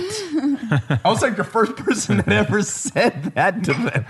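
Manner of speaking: high, slow, laughing through the words; recalling the neighbours' shock while laughing. Real, not acted. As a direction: laugh as you tell it.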